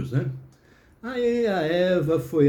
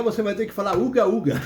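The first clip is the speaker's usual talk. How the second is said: a cheeky playground jeer; gleeful shout; 5 semitones higher than usual. Acted. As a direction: crow it at her like a kid who just won. Man gloats over winning the game.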